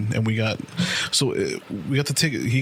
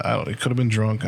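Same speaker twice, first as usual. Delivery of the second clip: ordinary talk, nothing marked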